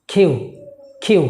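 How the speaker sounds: কিউ with a puff of air on the k; correct pronunciation. Shown as a demonstration. Teacher modelling the correct letter name, aspirated k. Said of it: "আর এটা হবে কি".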